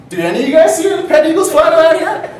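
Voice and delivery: very high, loud seagull call; seagull calling flock. Acted. Seagull asks the rest of the flock, high taunting shout. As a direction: holler it out high to the whole flock. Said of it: "to the rest of the flock and goes".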